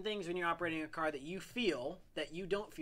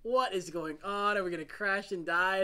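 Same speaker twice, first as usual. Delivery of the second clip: mild mock panic, a bit louder; retold freak-out; 2 semitones higher, 5 dB louder than usual. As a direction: act out a passenger's alarm, lightly. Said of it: "I'm, like, freaking out".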